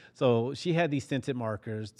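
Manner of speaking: ordinary talk, nothing marked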